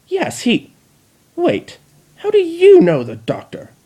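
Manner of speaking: very high, rising shocked question; sudden suspicion. Acted. Chrysalis suddenly suspicious of the Dalek, very high. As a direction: catch yourself mid-sentence.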